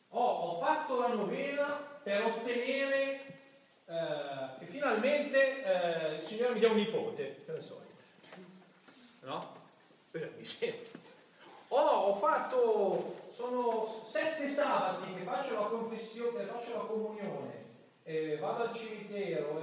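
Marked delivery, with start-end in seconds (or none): 0.1-7.4 louder, higher, slow pleading churchgoer; hammed-up imitation of a pleading grandmother; mock pious plea. Mocking a devout grandmother's bargaining. 11.7-19.6 higher, louder, slow listing of devotions; hammed-up devotee reciting her sacrifices; mock pious boasting. Mocking devotee listing her practices.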